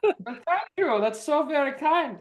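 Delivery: high, gracious mock villain; high theatrical thanks. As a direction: accept praise like a vain villain. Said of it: "prime villain character".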